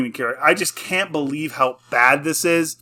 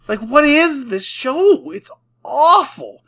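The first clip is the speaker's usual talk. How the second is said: exasperated outburst; 10 semitones higher, slower than usual. Real, not acted. To viewers about a show he hates, an exasperated high outburst. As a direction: throw up your hands at the show.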